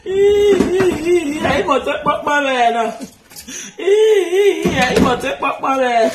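high, loud hammed-up wail with laughter; mock wailing. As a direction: wail like a child then laugh. Imitates childhood crying wail.

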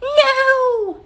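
high, loud witch wail; frightened story witch, shrill-pitched "no"; witch's frightened cry. A narrator's reading. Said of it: "cried the witch".

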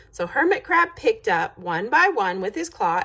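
ordinary delivery, nothing marked

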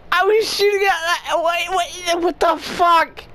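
high, wailing complaint; indignant disbelief. Real, not acted. Gamer complaining to viewers that his shots do nothing, whiny disbelief. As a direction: protest in exasperated disbelief.

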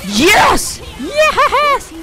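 high-pitched squeal of triumph; elated cheer. Real, not acted. Gamer celebrating a goal just scored, elated squeal. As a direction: let the victory burst out, very high.

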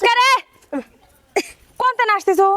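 high-pitched telling-off; loud scolding. Acted. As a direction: scold them as they turn up. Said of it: "কত জোরে ধমক দিচ্ছ কে?". (চড়া গলায় ধমক)

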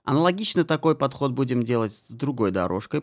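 ordinary talk

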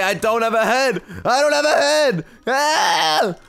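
high, frantic cries for help; mock panic. Headless patient begging hospital staff, high-pitched mock panic. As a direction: play up a panicked shout.

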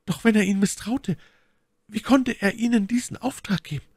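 very high falsetto lady's question; inquiring My Lady. A narrator's reading. Lady questioning her rescuer, high-pitched curiosity. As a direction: ask in a high, put-on lady voice.

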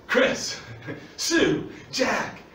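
singling people out one name at a time; calling out names. Acted. Jesus picks out listeners by name, calling out. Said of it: "it's a little bit like Jesus saying".

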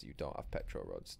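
a low, soft voice answering; a quiet low answer to his own question. Pretend. Answering himself in a different voice.

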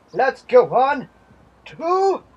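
high, slow squealing cheer; squealing yay. Pretend. Gamer moving on to next animal, squealing cheer. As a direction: squeal it out with glee.

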